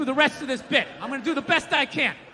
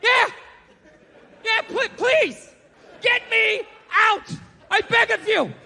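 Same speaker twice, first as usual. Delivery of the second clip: much higher, slow pleading shout; begging gorilla; 8 semitones higher, slower than usual. Retold or imitated. Gorilla begging the lady to free it. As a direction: beg desperately, hammed-up. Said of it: "the thing would be like".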